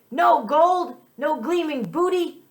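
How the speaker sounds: high, loud cry of disappointment; pirate's frustrated cry. Acted. Pirate finding only clean teeth, crying out high and loud. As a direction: wail it like a cheated pirate.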